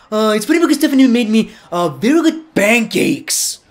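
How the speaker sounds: hammed-up wrestler voice, stretching the ending; Triple H impression. Wrestler talking to Stephanie, hammed-up impression. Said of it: "I can just do a Triple".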